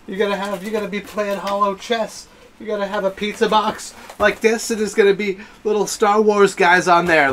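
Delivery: high-pitched, eager rush of hype; excited gushing. Real, not acted. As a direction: gush like a thrilled fan. Gushing about the hologram game.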